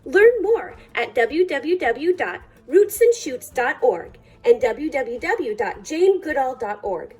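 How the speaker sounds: ordinary delivery, nothing marked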